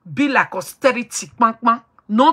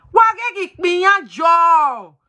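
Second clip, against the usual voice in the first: slow, raised protest a little higher; mock shout; 4 semitones higher, 3 dB louder, slower than usual. Quarrelling caller slowly shouts back at the madam.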